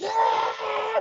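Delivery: very high, hammed-up crowd whoop; mock cheering crowd. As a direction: cheer like the whole boat.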